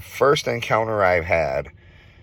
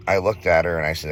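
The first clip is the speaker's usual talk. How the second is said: ordinary delivery, nothing marked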